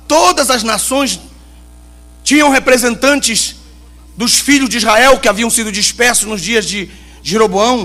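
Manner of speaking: loud, high impassioned sermon; fiery preaching. Acted. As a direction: preach it with full force. Preaching at full force.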